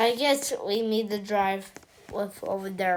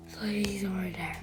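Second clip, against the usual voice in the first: whispered while hiding from him; 2 semitones lower, 9 dB quieter than usual. Pretend. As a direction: keep it hushed so he won't hear. Hiding in the garbage can.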